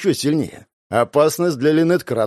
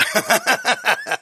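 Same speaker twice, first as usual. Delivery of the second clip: evil laugh; 9 semitones higher than usual. Acted. Villain gloats at victims.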